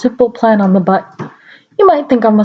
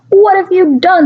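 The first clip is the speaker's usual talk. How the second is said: mock cry; 8 semitones higher than usual. A narrator's reading. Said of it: "he started to cry".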